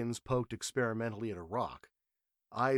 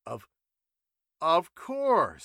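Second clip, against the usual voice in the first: higher, louder halting reply; flustered stammer; 7 semitones higher, 10 dB louder, much slower than usual. A narrator's reading. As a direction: stumble over the words, caught out. Matthews caught off guard.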